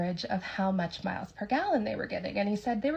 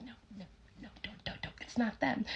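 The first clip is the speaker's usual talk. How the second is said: hushed, breathless warning; urgent whisper; 9 dB quieter than usual. Retold or imitated. Staffer waving the manager away from the table, urgent whisper. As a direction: whisper fast to stop him in time.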